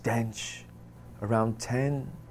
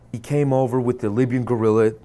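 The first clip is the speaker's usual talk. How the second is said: usual speaking voice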